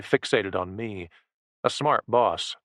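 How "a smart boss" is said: ordinary delivery, nothing marked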